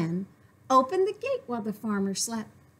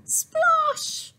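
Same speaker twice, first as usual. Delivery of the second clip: splash sound; 3 dB louder than usual. Acted. Splash into pond.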